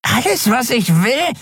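high, wheedling junkie voice; sleazy dealer. Acted. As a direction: lure them in like a creepy peddler. Dealer fasst nach ihr. (hoch und schmierig lockend)

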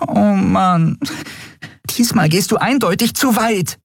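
high, tiny fairy voice, upset; a little winged friend scolding in alarm; agitated fairy. A narrator's reading. Nelen exasperated. (aufgeregte kleine Fee, hoch)

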